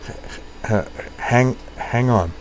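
stuttering hesitantly. A narrator's reading. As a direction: catch on each first sound. Kate stutters.

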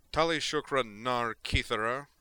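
commanding, scolding roar; bellowed. A narrator's reading. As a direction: call them to heel at full volume. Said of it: "Dareth bellowed, emphasizing the word that marked her as a child".

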